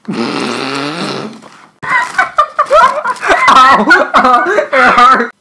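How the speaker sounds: suppressed whispery giggle; stifled laugh. Real, not acted. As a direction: laugh trying to hold it in. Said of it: "wasn't able to contain my laughter".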